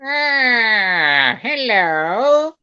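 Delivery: high, loud cartoon villain voice; Skeletor voice. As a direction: greet like a sly villain. Figure answers the new Skeletor.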